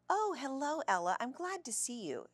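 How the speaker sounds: high-pitched cheery greeting; cheery lady's sing-song hello; loud, cheery. A narrator's reading. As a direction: greet her brightly, pitch way up. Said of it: "in her loud, cheery voice".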